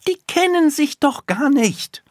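put-on very high, loud, emphatic little girl; forceful, pitched right up; energetic insistence. A narrator's reading. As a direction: hammer the point home as a child. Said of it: "sagt sie energisch".

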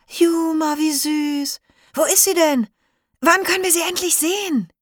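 put-on high, eager children's voices all at once; excited kids. Schoolkids beg the zookeeper to see the baby, high and eager. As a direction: squeal with delight like impatient schoolkids.

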